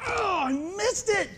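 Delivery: slow, drawn-out high oh of dismay; a slow, high groan of regret; dismayed realization. Retold or imitated. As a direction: let it dawn on you that you missed it. Realizing he missed God's moment.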